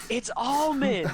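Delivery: high, slow, drawn-out shout; exasperated yell. Retold or imitated. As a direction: yell the correction at the end of your patience. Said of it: "crashes the car and jumps out and goes".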